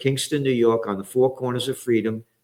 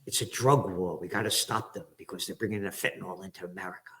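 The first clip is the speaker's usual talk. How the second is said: much softer, fast, mumbled parody; mock official excuse; 4 semitones lower, 11 dB quieter, faster than usual. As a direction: rattle off the excuse dismissively. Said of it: "under the bullshit".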